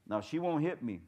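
ordinary talk, nothing marked

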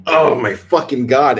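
loud, high mock exasperation; hammed-up disbelief at a cheesy joke; exasperated. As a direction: groan it out loudly as a retold last straw. Retold reaction to another cheesy line.

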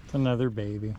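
ordinary talk

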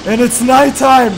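loud, high, slow complaint; exasperated wail. Real, not acted. Gamer realizing night has fallen, loud exasperated wail. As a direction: wail at the bad luck. Disaster piling up.

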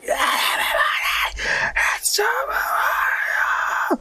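drawn-out, high, strangled scream. Shown as a demonstration. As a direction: scream the greeting till you nearly pass out. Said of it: "I'd be screaming like".

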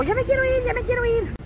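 put-on high, whiny child's voice; whiny kid. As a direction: whine it like an impatient little boy. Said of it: "Siempre se pone bien loco". (imitando a un niño quejumbroso, agudo)

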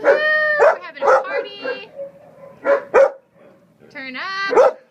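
high, loud, happy dog voice; excited dog voice. Retold or imitated. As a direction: voice the dog as a party animal. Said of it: "He's just like".

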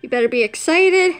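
ordinary talk

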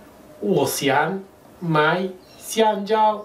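我想买香蕉 spoken very slowly, each syllable apart; teacher slowing down for learners; slow demo read; slow model phrase. Shown as a demonstration. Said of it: "yo puedo decir".

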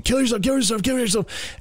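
high, strained chant, held back in volume; screeching imitation of relentless voices; mock scream. Tormenting voices goading him, mock scream. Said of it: "just, like, just screaming".

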